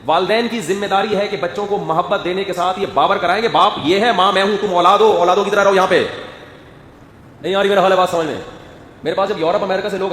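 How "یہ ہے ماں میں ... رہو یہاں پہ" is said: loud, firm laying down of the rules; stern parent. Pretend. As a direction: put the children in their place.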